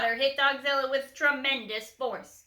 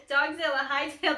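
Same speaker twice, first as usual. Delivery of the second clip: reading through a real laugh; 4 semitones higher than usual. Real, not acted. Reader cracks up at own pun, laughing through words. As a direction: giggle while saying it. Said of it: "that was funny".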